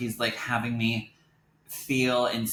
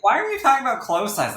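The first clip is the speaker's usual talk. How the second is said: higher and louder, indignant squeal of "Why are we talking about clothes size?"; an over-the-top offended teenager, pitched way up; 13 semitones higher, 7 dB louder, faster than usual. Retold or imitated. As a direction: replay the offence much bigger and higher. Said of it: "I was so offended".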